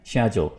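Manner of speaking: schedule with 'sh', SHED-jool; British pronunciation. Shown as a demonstration. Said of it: "इसकी आवाज़ आएगी". (schedule British ढंग से, 'शेड्यूल')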